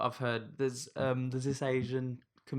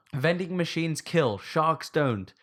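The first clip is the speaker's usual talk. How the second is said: slow, punchy headline read, a little higher; 5 semitones higher, 4 dB louder, slower than usual. Shown as a demonstration. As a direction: announce it like a tabloid headline. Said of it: "which was a headline".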